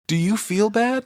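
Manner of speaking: put-on high small-boy voice; imitating Danny. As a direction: pitch it up like a worried little kid. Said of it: "He's like".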